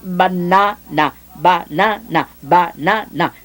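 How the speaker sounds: banana drawn out slowly, each syllable laid out; teacher spelling out banana; slow syllable demo. Shown as a demonstration. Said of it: "la sílaba ba".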